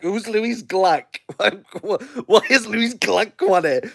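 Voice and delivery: high, laughing imitation of baffled people; mock bafflement. Everyone reacting to Louise Gluck winning, mock-baffled and laughing. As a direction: puzzle over the name through giggles.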